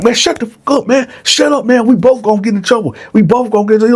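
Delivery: fast, high, harsh scolding; angry hushing. Retold or imitated. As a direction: hiss at him to shut up. Bone hushing Dylan angrily.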